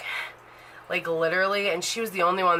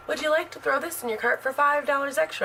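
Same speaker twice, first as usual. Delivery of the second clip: polite clerk voice; 7 semitones higher, faster than usual. Retold or imitated. Quoting the store's offer.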